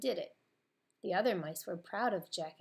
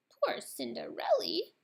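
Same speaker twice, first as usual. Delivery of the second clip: high, slow little mouse voice; squeaky mouse voice; 10 semitones higher, 5 dB louder than usual. A narrator's reading. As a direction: speak like a tiny worried mouse. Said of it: "a mouse named Jack said".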